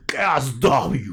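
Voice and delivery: slow, booming ring announcer call. Shown as a demonstration. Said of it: "I gotta say it like the announcer guy".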